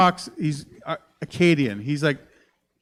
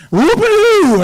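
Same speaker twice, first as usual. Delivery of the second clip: very high, loud silly holler; high-pitched comic outburst on one held note; goofy whoop; 10 dB louder than usual. Shown as a demonstration. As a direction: let out a long wacky whoop. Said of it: "just a whoopity-doo in here".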